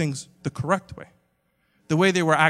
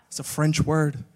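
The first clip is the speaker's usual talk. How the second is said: usual speaking voice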